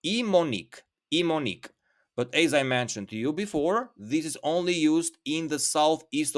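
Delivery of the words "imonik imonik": Imonik, each syllable said clearly; word demo. Shown as a demonstration. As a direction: Imonik spoken clearly for learners. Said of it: "you can also say Imonik".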